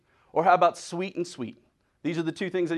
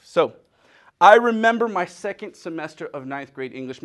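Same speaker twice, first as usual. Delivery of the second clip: ordinary talk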